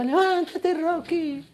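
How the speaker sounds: high, drawn-out mock call; imitated wife's voice. Rocky's wife calling him in his daze, high imitated voice. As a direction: put on a high wifely cry. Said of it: "escuchaba la voz de su esposa".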